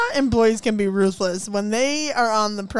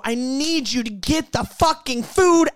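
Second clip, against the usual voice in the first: high, slow, pointed demand; slow, higher, exasperated order; angry demand; 6 semitones higher, 4 dB louder than usual. Retold or imitated. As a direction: spell it out to the boss, fed up.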